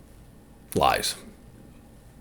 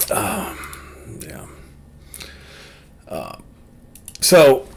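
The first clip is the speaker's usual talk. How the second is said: usual speaking voice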